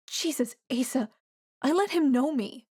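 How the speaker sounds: fighting back tears as she confesses; choked up. A narrator's reading. Diana confesses her betrayal to Asa, choked up. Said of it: "Diana chokes out".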